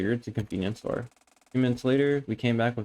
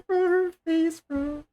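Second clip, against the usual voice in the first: high, squealing disbelief; shocked high-pitched bro; amazed squeal; much slower than usual. Real, not acted. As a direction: squeal at what you just saw. Reacting to girl biting popsicle.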